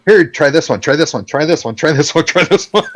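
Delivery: mock eager nagging, rising at the end; mock insistent offering. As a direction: keep pushing it on them, climbing in pitch. Imitating friend pushing vape flavors.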